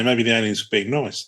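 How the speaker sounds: ordinary talk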